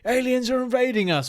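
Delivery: high-pitched mock alien-invasion alert; mock alarm. Vlogger imitating a staged UFO announcement, mock alarm. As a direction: raise the alarm, pitched right up, hammed-up. Mimics a fake official alarm.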